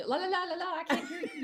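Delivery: high, loud la-la-la blocking out the news; mock not listening. Candidate drowning out her friend's spoiler, mock not listening.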